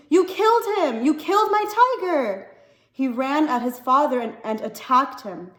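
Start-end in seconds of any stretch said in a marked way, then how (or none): 0.1-2.5 put-on high, loud furious boy's scream. A boy screaming at his father over the dead tiger. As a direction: scream it at him, heartbroken.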